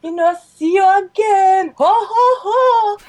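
put-on very high, loud old-lady singsong; hammed-up high goodbye with hooting; grandma's cheery farewell. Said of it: "And she's like".

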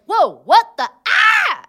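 loud yelp leaping into a high scream; startled scream. Acted. Player acts her character's sudden shock, startled scream. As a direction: build from shocked shout to shriek. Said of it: "she was just like".

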